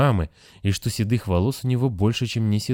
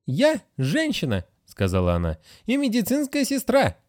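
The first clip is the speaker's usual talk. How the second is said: offended nurse; 13 semitones higher than usual. A narrator's reading. Nurse Agatha insists on her status, high and offended. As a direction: stand on your dignity. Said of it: "nurse insists".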